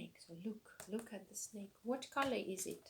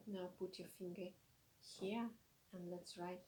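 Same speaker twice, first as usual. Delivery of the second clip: usual speaking voice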